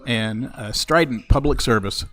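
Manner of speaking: loud mock press announcement; booming formal announcement, deadpan; announcer voice.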